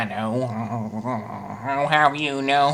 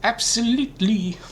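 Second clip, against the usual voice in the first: high, silly put-on voice; 7 semitones higher than usual. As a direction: pitch it way up like a cartoon. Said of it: "Silly voice man does the voice".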